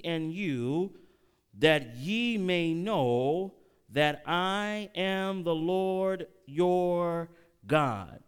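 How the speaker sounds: slow, solemn reading of the verse; scripture reading. Acted. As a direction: draw each word out like holy writ. Preacher reads scripture slowly.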